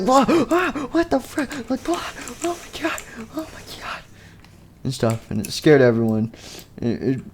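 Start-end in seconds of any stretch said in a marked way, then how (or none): 0.0-4.0 very high, thin squeaks fading softer; mock panic. Everyone wakes up scared.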